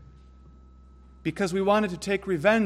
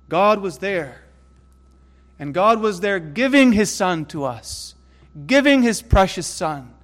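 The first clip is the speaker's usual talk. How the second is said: higher, louder preaching climax; preaching at full force; 4 semitones higher, 5 dB louder than usual. Acted. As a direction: build the crescendo of the gospel.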